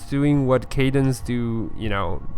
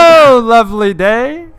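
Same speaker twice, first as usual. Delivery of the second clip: higher, louder cheerful greeting; cheery passerby; 6 semitones higher, 8 dB louder than usual. Acted. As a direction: greet the street brightly. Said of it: "They're like".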